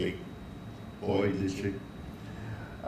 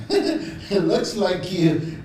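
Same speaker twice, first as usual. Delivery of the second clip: laughing; 9 semitones higher, 4 dB louder, much slower than usual. Real, not acted. Reading a comment, laughing.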